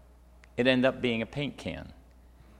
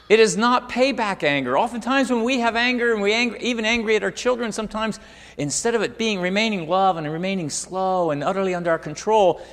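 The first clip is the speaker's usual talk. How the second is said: high, loud, emphatic preacher's voice; full-force preaching; 9 semitones higher, 8 dB louder than usual. Acted. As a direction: punch out each point to the congregation.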